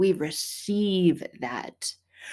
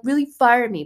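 mock outrage; 5 semitones higher, 9 dB louder than usual. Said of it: "And you're like".

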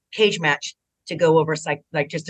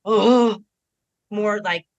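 ordinary talk

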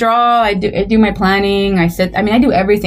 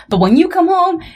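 raised, pleading mock scolding; mock lecture; 7 semitones higher than usual. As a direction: lay it on like a wife scolding her husband. Wife lecturing husband in a retold quote.

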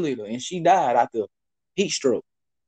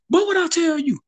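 high, loud imitated coach; the coach yelling at his player; 13 semitones higher, 4 dB louder than usual. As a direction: play the angry coach, pitched up. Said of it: "and the coach said".

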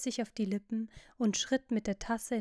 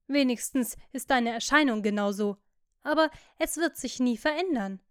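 usual speaking voice

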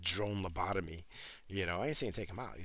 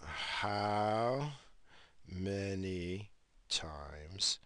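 slow, word by word, into the phone; dictating a question, one word at a time; slow dictation; 2 semitones lower, much slower than usual. Real, not acted. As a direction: spell it out slowly for the voice search.

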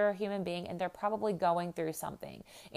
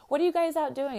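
much higher, upbeat curious ask; friendly barista question; 10 semitones higher, 4 dB louder than usual. Retold or imitated. Barista chatting with mom and kids, high upbeat question. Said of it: "And he was like".

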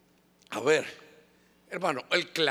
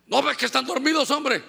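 higher, louder indignant snap; angry retort; 6 semitones higher, 4 dB louder than usual. Retold or imitated. Angry mother snaps at the pastor again. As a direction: bite back hard.

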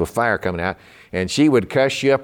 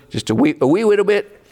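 mock Irish lilt; 10 semitones higher, 5 dB louder than usual. Playful Irish-ish aside.

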